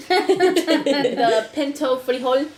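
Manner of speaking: high, slow, laughing title call; laughing announcement. Acted. Cohost adding a joke title, laughing. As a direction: give the segment nickname through laughter.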